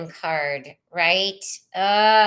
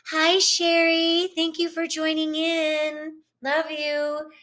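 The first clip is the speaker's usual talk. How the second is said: higher, slow, sweet hello to a viewer; sweet greeting; 8 semitones higher, slower than usual. Real, not acted. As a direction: greet her like a dear friend.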